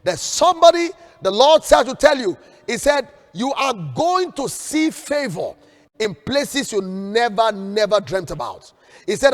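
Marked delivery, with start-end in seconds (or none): 3.3-8.7 slow, solemn voice of God, fading softer; slow solemn prophecy trailing off; prophetic voice. Acted. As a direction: speak it as the Lord's promise.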